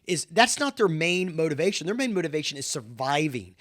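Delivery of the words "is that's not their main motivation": ordinary talk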